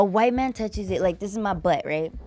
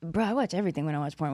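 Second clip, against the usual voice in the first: fast, breathy whispered confession; 6 semitones lower, 7 dB quieter, much faster than usual. Real, not acted. Admitting her porn habits to her co-host in a whisper. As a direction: hush it like a naughty secret.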